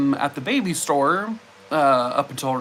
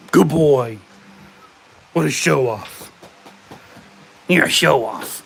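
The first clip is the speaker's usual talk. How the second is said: loud, gushing pet praise; praising the dog; 5 dB louder than usual. Real, not acted. Praising her dog after he brings the ball, loud gushing. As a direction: cheer the dog on after it fetches.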